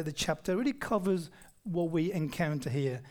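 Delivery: ordinary delivery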